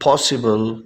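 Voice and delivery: "Possible" with a rounded British O; British pronunciation. Shown as a demonstration. Teacher shows students how the UK says it, British pronunciation. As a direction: say "Possible" the UK way, first vowel an "aw". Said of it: "یو کے والے اس کو کیسے پروناؤنس کرتے ہیں".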